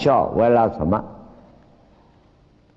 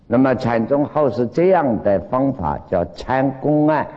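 ordinary delivery

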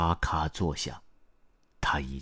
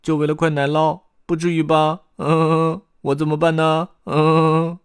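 high, loud whiny little voice; squeaky, fussing glutton; Beiliao; 12 semitones higher, 9 dB louder than usual. A narrator's reading. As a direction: fret in squeaks between hums. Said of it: "贝利奥说".

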